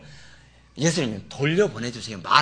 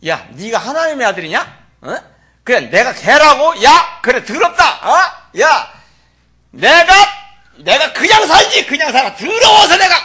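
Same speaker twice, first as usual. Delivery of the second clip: loud, higher, laughing mock outrage; indignant outburst; 8 semitones higher, 12 dB louder, slower than usual. A modern woman insulted by Jesus, loud mock outrage. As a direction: storm off insulted, half laughing. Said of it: "요즘 말로 말하면 어떻게 했을까".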